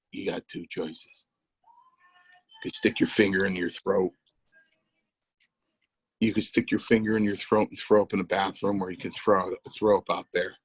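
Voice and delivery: a lightly voiced deadpan Eastwood-style threat to the rookie; Clint Eastwood stare. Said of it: "he looked at me like Clint Eastwood".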